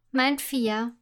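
usual speaking voice

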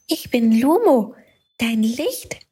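a gentle magic light introducing itself; little light Lumo. A narrator's reading. (leicht höhere Stimme des kleinen Lichts)

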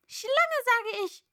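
high, loud screeching bird; insistent pigeon. A narrator's reading. Pigeon insisting to Alice, high and screeching. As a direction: screech it back, stubborn. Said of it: "wiederholte die Taube".

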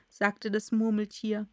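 usual speaking voice

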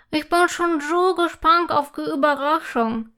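talking through a mouthful of cake; eager words round a bite; mouth full. A narrator's reading. Girl at the table, speaking with her mouth full. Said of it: "sagt Imme mit vollem Mund".

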